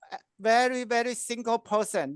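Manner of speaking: usual speaking voice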